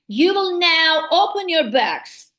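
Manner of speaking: stern teacher ordering the class, slow and emphatic; commanding tone. Shown as a demonstration. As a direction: spell it out slowly as a firm order. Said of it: "there is the intonation".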